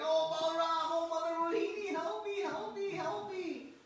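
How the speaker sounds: put-on high, wailing little boy calling for help; child crying for help. Tied-up child Krishna calling to his brother for help, high wailing cry. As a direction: wail like a small child begging to be rescued. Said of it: "then he starts crying".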